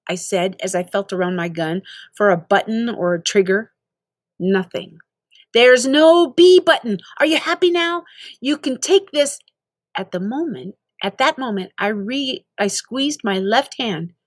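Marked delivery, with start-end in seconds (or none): 5.5-9.4 exasperated outburst. Acted.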